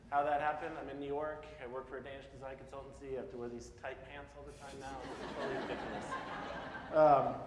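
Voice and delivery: ordinary talk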